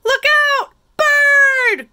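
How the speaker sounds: high, loud warning shout; shrieked storybook panic; panicked cry. A narrator's reading. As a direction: yell the alarm as storybook snails. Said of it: "cried the snails in a panic".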